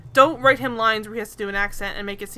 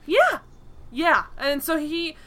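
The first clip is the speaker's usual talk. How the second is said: ordinary talk, nothing marked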